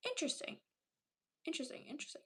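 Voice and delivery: a hushed "interesting, interesting", mock thoughtful; whispered musing. Real, not acted. Mock-pondering chat's fact.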